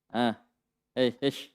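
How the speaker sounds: ordinary talk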